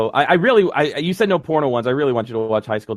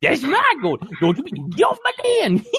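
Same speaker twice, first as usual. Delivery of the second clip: put-on high, cackling old prospector yelling; guard your gold like a crazed miner; gruff mountain man; 10 semitones higher than usual. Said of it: "the old Prospector's always my favorite".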